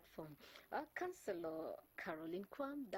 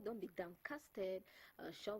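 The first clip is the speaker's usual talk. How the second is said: usual speaking voice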